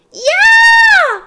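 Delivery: loud, piercing scream of a yanked pigtail; very high, full-out yell; high-pitched scream. A narrator's reading. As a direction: scream out in sudden outrage. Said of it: "screamed Leslie".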